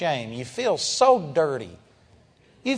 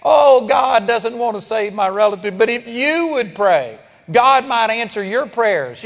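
hammed-up pleading cry, louder; pleading believer; 3 semitones higher, 3 dB louder than usual. Preacher mocking a believer who asks him to pray, hammed-up pleading. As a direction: mimic a believer pressing you to pray. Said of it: "When you're pleading and saying".

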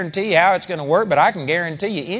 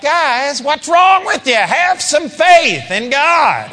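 much higher, louder, slow scolding; exasperated; 9 semitones higher, 6 dB louder, much slower than usual. Shown as a demonstration. Jesus to disciples, exasperated. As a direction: scold them in disbelief. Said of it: "I believe it was like".

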